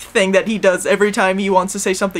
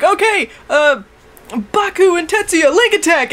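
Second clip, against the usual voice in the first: attack call; 6 semitones higher than usual. Retold or imitated. Voices Gao calling an attack.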